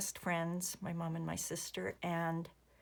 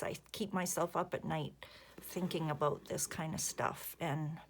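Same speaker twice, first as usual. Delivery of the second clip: ordinary talk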